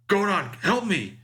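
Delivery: high, slow cry for help; Van and Doba, frantic. Acted. Villain on the intercom begs Godon for help, crying out high. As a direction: call out desperately, pitched well up. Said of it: "Van and Doba is like".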